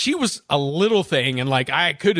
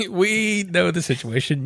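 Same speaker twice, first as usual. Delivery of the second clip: mock knowing reply; 4 semitones higher than usual. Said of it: "echoing the kids' reply".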